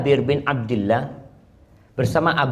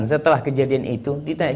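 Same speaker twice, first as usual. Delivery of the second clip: usual speaking voice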